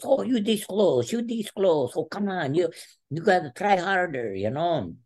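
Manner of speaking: high-pitched, insistent coaxing; imitated coach encouragement. Coach telling wrestler he was this close, high-pitched encouragement. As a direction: urge him on in a high imitated voice.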